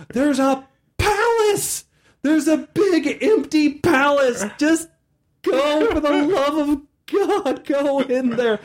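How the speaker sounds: high, slow, begging; hammed-up desperate GM plea; desperate pleading. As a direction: beg them, half laughing, for the love of God. GM begging players to explore.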